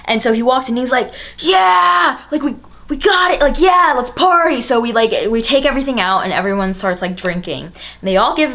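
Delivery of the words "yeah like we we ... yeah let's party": high, loud party cheer; whooping mock victory shout; victorious yell. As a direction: imitate a friend storming in triumphant.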